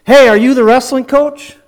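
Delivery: loud, high call down a long hallway; a stranger calling out to the coach, loud and high; yelling across the hall. Retold or imitated. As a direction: shout it to someone seventy yards away. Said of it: "and he yells".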